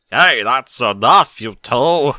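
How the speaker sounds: loud, slow mom voice breaking up a scuffle; calling out to stop. A narrator's reading. Mother telling two wrestling foals to stop, loud slow call. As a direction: stretch it out to halt the kids.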